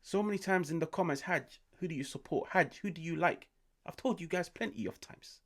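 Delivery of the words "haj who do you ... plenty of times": ordinary delivery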